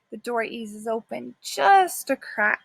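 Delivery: ordinary delivery, nothing marked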